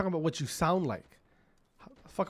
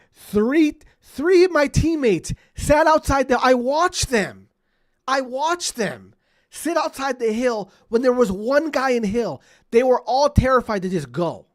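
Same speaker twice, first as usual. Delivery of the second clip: loud, high-pitched outraged rant; worked-up, near-shouted complaint; exasperated rant; 8 semitones higher, 10 dB louder than usual. Real, not acted. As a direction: raise your voice in exasperated disbelief at useless teammates. Gamer ranting about cowardly teammates.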